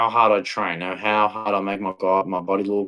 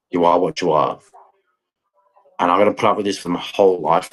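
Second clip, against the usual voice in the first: usual speaking voice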